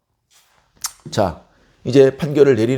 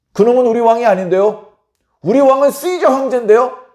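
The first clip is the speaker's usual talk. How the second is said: high, raised, questioning retort; insolent protest; 8 semitones higher, 5 dB louder than usual. Acted. Chief priest disowns his king, high loud retort.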